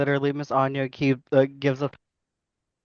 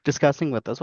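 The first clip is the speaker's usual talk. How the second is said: ordinary delivery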